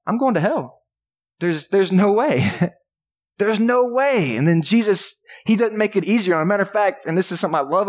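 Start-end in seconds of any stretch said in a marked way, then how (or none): 0.1-4.4 despairing crowd member. Acted. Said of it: "they're just thinking".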